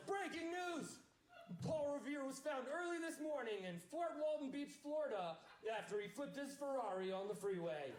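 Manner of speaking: lightly voiced upset newscaster; news anchor. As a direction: announce it like a TV anchor. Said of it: "And he says".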